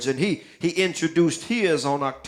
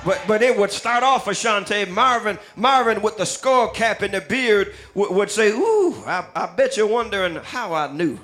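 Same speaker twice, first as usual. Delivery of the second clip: preaching the lyrics; 4 semitones higher than usual. Acted. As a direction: preach the song lines to the church with mounting fire. Preacher declaims song lyrics, rising.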